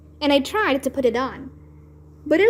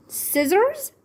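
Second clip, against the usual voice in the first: high alarmed question; 8 semitones higher than usual. A narrator's reading. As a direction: gasp the word out in alarm.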